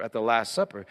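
ordinary talk, nothing marked

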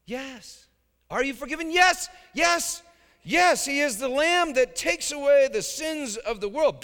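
high, loud shouted joy; exultant yes. Real, not acted. Preacher shouting yes to the congregation, jubilant and loud. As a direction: proclaim it with full triumph.

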